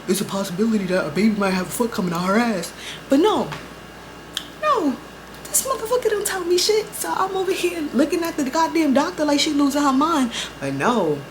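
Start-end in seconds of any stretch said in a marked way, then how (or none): 0.1-2.7 fast, casual mock announcement; mock helpful warning. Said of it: "I would be like". 3.1-8.9 exasperated rant. Real, not acted. Ranting about boyfriend's secret.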